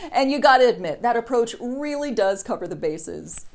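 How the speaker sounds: normal talking voice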